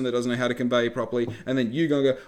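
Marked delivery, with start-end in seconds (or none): none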